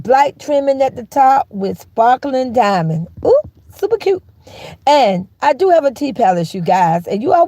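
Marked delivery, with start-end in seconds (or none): 3.2-4.2 high, delighted squeal of approval; gushing. Acted. To chat about her golden gate, delighted high coo. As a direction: coo over how cute it is.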